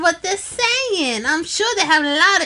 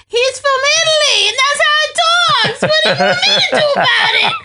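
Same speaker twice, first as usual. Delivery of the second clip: high, louder outburst breaking into laughter; escalating indignation; 7 semitones higher, 3 dB louder, faster than usual. Real, not acted. Child defending a snail's Italian voice to a critic, escalating indignation. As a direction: protest loudly in a rising, high-pitched voice. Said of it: "you just escalated in volume".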